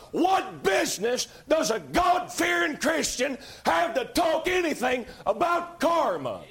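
preaching shout. Real, not acted. Preacher thundering rhetorical question.

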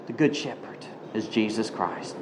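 normal talking voice

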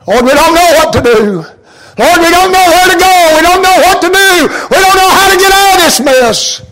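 high, loud pleading cry to God; desperate prayer. Retold or imitated. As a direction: beg God from the storm. Voiced prayer of frightened sailors.

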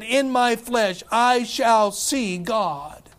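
slow, drawn-out solemn words; slow and solemn, quoting Job; slow solemn quote. Acted. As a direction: let each word land slowly. Preacher quoting Job dramatically.

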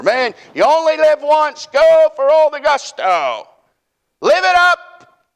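high, drawn-out hyped slogan; mock party-hard ad voice, stretched out; commercial slogan. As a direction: crow it like a live-it-up commercial. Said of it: "How many people remember that commercial?".

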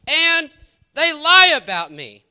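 slow, loud, wounded cry; indignant outburst. Retold or imitated. Retelling his anger at the lying family, loud indignant cry. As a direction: let the outrage burst out, drawn out. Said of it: "How dare this person lie".

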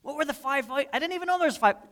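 high, fast flustered voice; confused listener. Retold or imitated. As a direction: sound lost and flustered. Mimicking forgetful listener.

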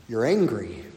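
low, soft You're angry, dropped flat; quiet verdict. Shown as a demonstration. Preacher answering the excuse, low and soft. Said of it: "let's just use biblical language".

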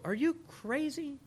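slow, high scoffing question; mocking disbelief. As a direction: sneer at a new believer. World mocks the believer.

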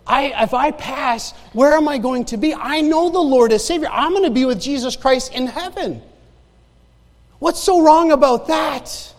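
high, insistent rhetorical challenge; impassioned preaching. Acted. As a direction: preach it as a joyful certainty. Preaches his hope of heaven.

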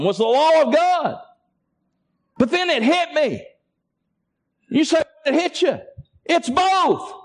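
a preacher at fever pitch, voice straining high; fiery preaching. Acted. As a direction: preach it hard, building to a climax. Sermon rising to full force.

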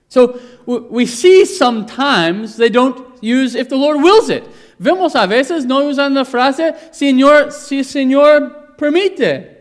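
loud, high, emphatic preaching; preaching at full force. Acted. Preacher pressing the church, loud and rising. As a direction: drive it home to the congregation.

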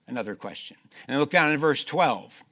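normal talking voice

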